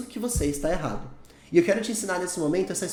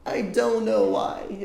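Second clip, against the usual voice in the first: slow demo; 4 semitones higher, much slower than usual. Shown as a demonstration. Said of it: "tem que ter afirmação aqui".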